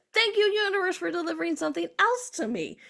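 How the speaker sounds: high, exclaimed gratitude; delighted thanks. Retold or imitated. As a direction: call out amazed thanks to the universe. Retold delighted thanks to universe.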